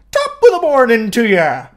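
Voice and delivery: loud, high hammed-up Irish accent greeting; a cheery stage-Irish hello, belted out; mock Irish accent. Caller faking an Irish accent to fool police, loud hammed-up Irish accent. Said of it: "answering the phone with an accent".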